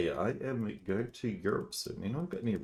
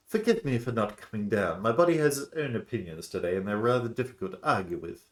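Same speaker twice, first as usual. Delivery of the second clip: higher, louder character voice; apologetic ailing character; 4 semitones higher, 6 dB louder than usual. Acted. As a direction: politely excuse yourself as an ailing guest. Reads unwell woman's dialogue.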